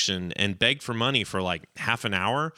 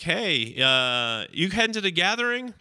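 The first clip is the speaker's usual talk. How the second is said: higher, casual acted hail; calling to hitchhiker; 9 semitones higher than usual. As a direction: pitch it up as a friendly question.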